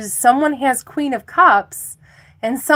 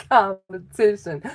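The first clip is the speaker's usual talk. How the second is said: ordinary talk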